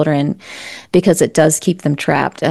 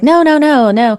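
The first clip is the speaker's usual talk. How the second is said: son protesting; 8 semitones higher, 3 dB louder than usual. Retold or imitated. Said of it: "he was like".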